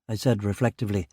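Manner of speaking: ordinary delivery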